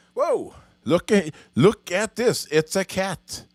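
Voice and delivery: high, loud delighted interruption; surprised exclamation. Real, not acted. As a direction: break off, startled by a cat. Spots a cat mid-talk.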